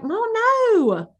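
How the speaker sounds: put-on high Southern child's whine; Southern accent. Southern kid refusing mom, high drawn Southern accent. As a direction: pitch it up like a little Southern kid. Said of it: "a really good Southern accent".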